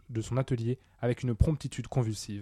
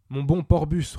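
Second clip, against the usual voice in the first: loud, raised-pitch appeal to a friend; agitated address, loud and high; urgent appeal; 4 semitones higher, 8 dB louder, slower than usual. Acted. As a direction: call on him urgently, voice lifted. Old man turns, appealing to his friend.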